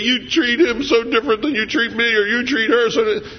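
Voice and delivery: high-pitched, imitated accusation of unfairness; complaining grown kid. Grown kids accuse parent of favoritism, mock complaint. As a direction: mimic an aggrieved child's complaint.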